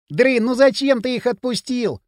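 high-pitched, plaintive groan; moaning complaint. A narrator's reading. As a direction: whine to the boss in protest. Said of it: "простонал Бивень". (высоко, со стоном)

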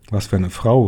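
ordinary delivery, nothing marked

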